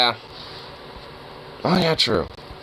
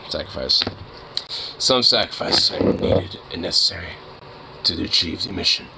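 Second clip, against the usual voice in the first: low, hammed-up grave voice; mock solemn commander; 6 semitones lower, 4 dB quieter than usual. Player justifies the dog's sacrifice, mock solemn and low. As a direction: pretend to be a stern mission leader.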